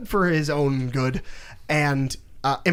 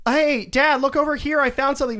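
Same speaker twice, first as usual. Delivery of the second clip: put-on higher, eager kid's voice; eager son; 9 semitones higher than usual. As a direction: chirp it like an excited young boy. Son calls father in retelling.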